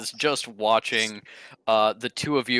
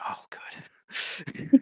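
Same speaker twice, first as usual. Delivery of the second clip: whispered "oh good" from the onlookers; relieved whisper; 3 dB quieter than usual. Acted. Office crowd whispers their relief together. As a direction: breathe it out in a hush. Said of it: "Everybody goes".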